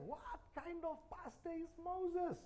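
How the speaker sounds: slow, high mocking whine; high whining complainer. Israelites mocking Moses to each other, high slow whine. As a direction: squeak out the grumble like a petty complainer. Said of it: "They said".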